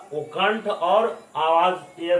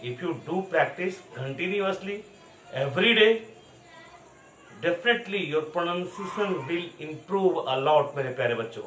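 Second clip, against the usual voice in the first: put-on slow, spelled-out advice for children; every word slow so kids follow; slow encouragement; slower than usual. Shown as a demonstration. Teacher urging children to practise, slow and clear. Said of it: "बार-बार सुनना है और practice करना है".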